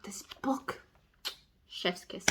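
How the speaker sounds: soft, reverent whisper. Retold or imitated. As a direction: breathe out your admiration. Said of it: "I'm like".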